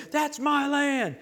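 heated land dispute. Retold or imitated. Mocking townsfolk fighting over land.

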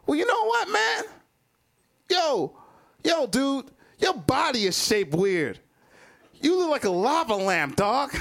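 high-pitched, indignant roast; acted-out heckler's retort, worked up; frustrated comeback. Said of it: "I just was so frustrated".

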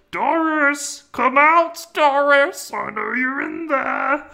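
high, far-off sing-song calling for Doris; creepy Mister Bean call. Retold or imitated. Bean calling Doris from his car, high creepy sing-song. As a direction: call her out of the house like a stalker. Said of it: "you hear him go".

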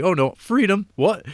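high, loud quote; mock dismay. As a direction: squeal it in fake alarm. Acting Eric Idle character.

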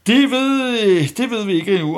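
excited burst. Acted. Satirical outburst after Freudian slip.

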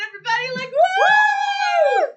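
sung then a loud whooping cheer; party cheer. Acted. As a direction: whoop it up like the party just started. Character hypes the dance floor.

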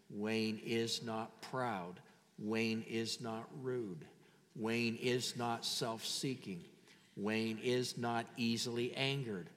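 slow, low, soft measured recitation; really slow. Shown as a demonstration. Pastor leading congregation with their own names, slow and hushed. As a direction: lead them quietly, spacing every word out. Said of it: "I'm gonna say it really slow".

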